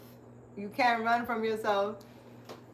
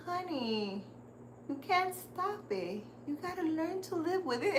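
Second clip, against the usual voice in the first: put-on sweet old psychic; 7 semitones higher, 7 dB quieter than usual. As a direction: coo it like a kindly grandma giving advice. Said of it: "She's like".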